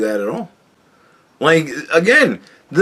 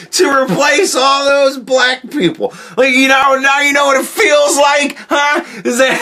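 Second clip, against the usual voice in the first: louder and higher, gloating taunt; mock gloating shout; 10 semitones higher, 3 dB louder than usual. Streamer taunting his imagined opponents, shouting and gleeful.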